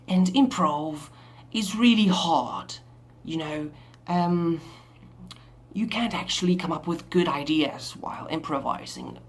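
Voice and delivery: put-on British English accent. Shown as a demonstration. Vlogger asking viewers not to hate the improv, British accent. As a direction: improvise in an exaggerated British lilt. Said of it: "British sounds like, to me at least".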